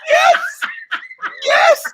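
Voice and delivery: loud, high-pitched shriek of yes; excited agreement. Real, not acted. Comic cheers the punchline.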